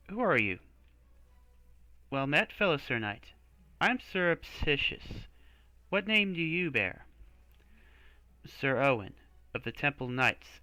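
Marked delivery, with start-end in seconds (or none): none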